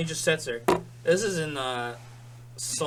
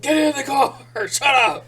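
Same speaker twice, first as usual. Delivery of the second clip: high, loud command shout; 13 dB louder than usual. Retold or imitated. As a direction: yell him into the car. Acted yelling at friend.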